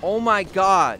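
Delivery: exasperated outburst. Real, not acted. Exasperated at game setback.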